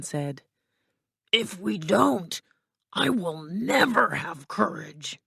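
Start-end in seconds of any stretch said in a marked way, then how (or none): 1.3-5.2 slow, put-on lion voice; worried Cowardly Lion. Lion laments to friends about courage, slow creature voice. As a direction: lament slowly as a husky lion.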